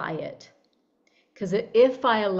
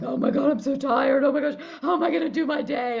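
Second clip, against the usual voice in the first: higher, louder, fast mock whine about being tired; groggy morning complaint; 6 semitones higher, 3 dB louder, faster than usual. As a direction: rattle off a hammed-up morning moan. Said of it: "I wake up in the morning and I'm like".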